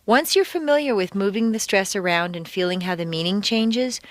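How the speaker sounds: ordinary talk, nothing marked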